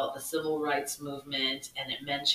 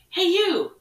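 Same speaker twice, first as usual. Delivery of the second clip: much higher, loud call across; yelling out; 11 dB louder than usual. Retold or imitated. Teacher at the portable door, calling out loudly. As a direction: call out to the boy. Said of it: "I yell out".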